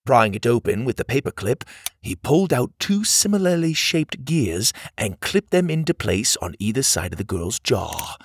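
usual speaking voice

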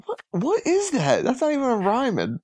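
stunned disbelief at a cheesy line; surprised. Real, not acted. Baffled reaction to the song.

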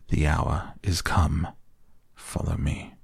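ordinary delivery, nothing marked

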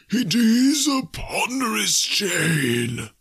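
slow, high, wailing spectre; ominous ghost. A narrator's reading. Ghost warning Scrooge of his own chain, ominous wail. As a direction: draw out the warning like a haunting.

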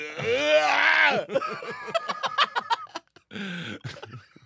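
long, loud, high mock wail; hammed-up newborn howl in a tough-guy voice; Stallone birth wail. Tough-guy actor being born, long comic howl. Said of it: "Stallone being born".

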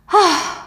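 sigh. Acted. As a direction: let out an audible huff. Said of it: "And she sighs".

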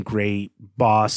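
normal talking voice

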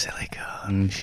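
slow, very soft whispered insult; bedtime lullaby whisper. Shown as a demonstration. Guest told he could put his kid to bed with it, soothing whisper. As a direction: whisper it slowly as if putting a child to bed. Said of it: "put your kid to bed with that".

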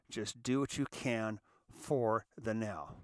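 slow, deliberate, each word spaced out; slow it right down so it sinks in; said slowly. Shown as a demonstration. Repeating advice to listeners in recovery, said slowly. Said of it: "Let me say that slowly again".

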